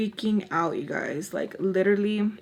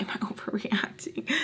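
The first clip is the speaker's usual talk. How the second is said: whispering, much softer; panicked whisper; 9 dB quieter than usual. Retold or imitated. Narrator asking herself in a scared whisper. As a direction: hush it as if to yourself in a panic.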